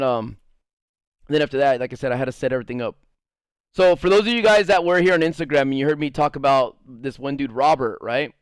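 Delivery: ordinary talk, nothing marked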